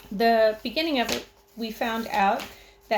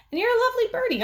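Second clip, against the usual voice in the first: put-on high sweet baby talk; grandma cooing to a pet; 4 dB louder, much faster than usual. A narrator's reading. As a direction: coo it at the bird.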